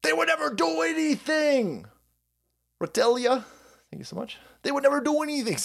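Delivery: high, sing-song sarcasm, insisting they'd never; sarcastic mock innocence. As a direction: pretend to be a naive CIA fan, mocking it. Sarcastic CIA refrain repeated.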